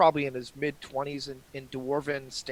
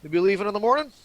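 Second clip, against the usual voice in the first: raised, lively dwarf voice; dwarf character voice; 5 semitones higher, 6 dB louder, faster than usual. Acted. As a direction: announce bedtime as a hearty dwarf. Rory stretches, turns in for night.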